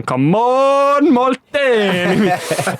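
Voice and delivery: high, drawn-out hammed-up heckler shout; mock fan yell. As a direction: bellow it like an old fan at the back. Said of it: "he just keeps going".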